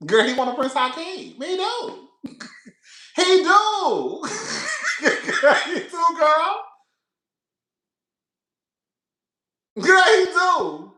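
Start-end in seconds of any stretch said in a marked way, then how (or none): 1.4-10.9 high, loud, cracking up; hollering with laughter at her own joke; laughing shout. Real, not acted. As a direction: shout it through big laughs. Laughing hard at Gerald's wish.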